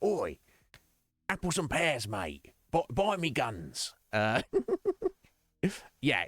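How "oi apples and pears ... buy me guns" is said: lightly mocked cockney barrow boy; a hint of hammed-up cockney trader; remake merchant. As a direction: hawk guns like a market geezer. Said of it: "he just sounds like".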